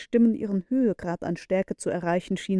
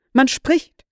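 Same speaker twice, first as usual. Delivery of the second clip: excited; 5 semitones higher, 8 dB louder than usual. A narrator's reading. Hears voices through the wall.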